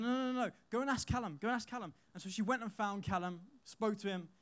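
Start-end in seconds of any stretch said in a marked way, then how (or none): 0.0-1.9 higher, urgent protest; pleading denial. Retold or imitated. Boy pleading innocence to the angry teacher. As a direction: insist quickly, begging her to check. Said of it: "I was like".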